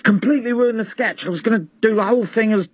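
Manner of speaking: high-pitched irate rant; exasperated tantrum. Acted. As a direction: squeal at a hapless assistant, pitch way up. Rants at assistant over ruined sketch.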